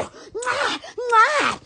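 playful air kisses; blown kisses. Pretend. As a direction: blow a kiss at the camera. Blows kisses.

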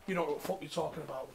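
ordinary talk

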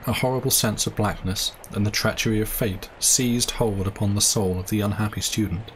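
ordinary delivery, nothing marked

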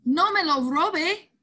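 put-on old man protesting the boys take his hat. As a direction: push back hard, drawing the words out. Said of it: "protestó el señor anciano".

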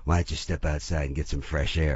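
lightly imitated film star, low and soft; Clint Eastwood. Star telling the coughing extra to get some air, low and quiet. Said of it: "He goes".

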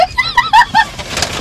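high squealing burst of laughter; shrieking laugh. Real, not acted. Reaction to vegan cinnamon roll.

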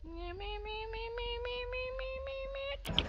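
very high, soft string of "me"; squeaky "me-me-me". Acted. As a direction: hold a high note on repeated "me". Silly repeated noise while playing.

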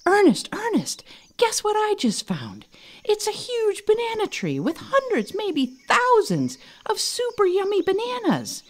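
high, excited monkey voice; bursting with news, tumbling out; excited, breathless. Acted. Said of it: "so excited that he was out of breath".

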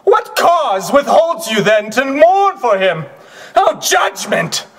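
high, loud declamation to a crowd; ringing stage shout, pitched high; impassioned oration. Acted. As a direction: cry it out to the crowd in grief and outrage. Actor performing Shakespearean speech on set.

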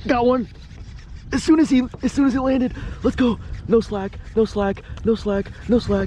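high, loud, thrilled yelling; real fish-on excitement. Real, not acted. Angler hooked up, yelling high and loud. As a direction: shout it high as the fish hits.

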